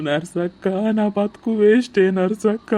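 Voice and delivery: crying in hopeless appeal; tearful wailing. Acted. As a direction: sob between phrases. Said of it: "ఆగురా, దొరుకుతుంది".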